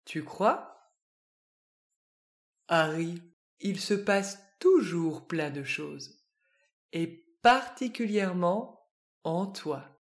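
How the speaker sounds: low, soft wise teacher voice; wise worm. A narrator's reading. The worm Home teaching Harry, low and soft. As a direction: speak like a calm sage, softly. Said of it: "affirme Home".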